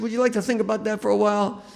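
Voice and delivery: high, fast director's question. Retold or imitated. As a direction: rush it up high like a nervous director. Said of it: "he said".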